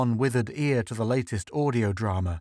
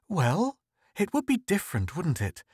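a higher, hedging wifely answer; wary, tentative; 7 semitones higher than usual. A narrator's reading. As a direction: feel your way as if sensing a trap. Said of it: "She sensed a trap".

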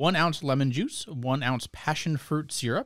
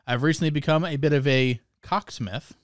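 ordinary talk

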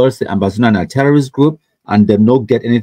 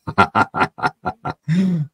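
a burst of high laughter; high, amused laugh at the comment; 7 semitones higher, 5 dB quieter than usual. Real, not acted. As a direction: laugh out at the joke.

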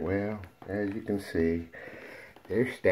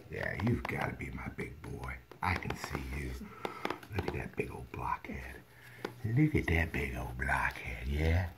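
soft lower whisper to the puppy; whispered praise; 6 semitones lower, 8 dB quieter than usual. Real, not acted. Owner admiring the biggest puppy, soft whisper. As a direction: whisper fondly to the big pup.

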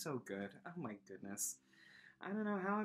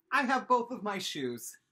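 proud exclaim; 6 semitones higher, 10 dB louder than usual. Acted. Joke about crazy morning.

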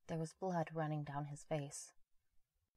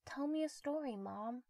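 put-on much higher, small child asking; eight-year-old boy; 8 semitones higher than usual. Young son asks his mother for a story, child voice. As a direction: plead like a little kid at bedtime. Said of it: "into Todd as an eight-year-old".

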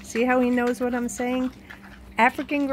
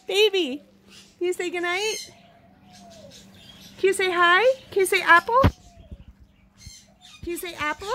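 higher coaxing questions to a bird; 6 semitones higher, faster than usual. Real, not acted. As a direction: coax the parrot to speak. Asking parrot to talk.

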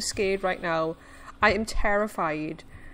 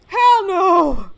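loud, high cry of refusal; high, alarmed yell; panicked shout; 7 semitones higher, 9 dB louder than usual. Real, not acted. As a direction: yell it out in fright. Panicking at sea creature.

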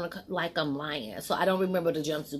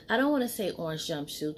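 ordinary talk, nothing marked

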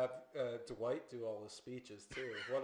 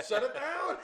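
laughing; 13 semitones higher, 7 dB louder than usual. Real, not acted. Joking alarm, laughing.

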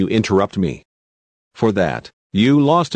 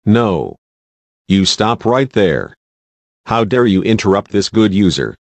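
louder angry scolding; getting mad; 3 dB louder than usual. Acted. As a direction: lose patience and scold. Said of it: "I'm already getting mad now".

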